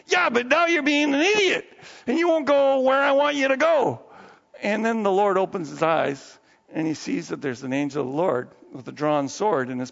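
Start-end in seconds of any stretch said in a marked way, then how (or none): 0.1-4.0 high, acted angry retort; exasperated Balaam. Balaam argues back at his donkey, exasperated. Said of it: "And Balaam's like".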